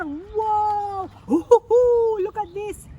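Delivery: high, loud gasp of delight; excited wonder. Pretend. Narrator showing children the paint mess, thrilled and high. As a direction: marvel at the mess for the kids.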